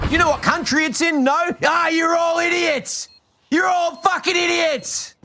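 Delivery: high, slow, shouted mock outburst at the chat; mock exasperated rant. Streamer ranting at chat, hammed-up shouted exasperation. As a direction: rant at them in hammed-up despair.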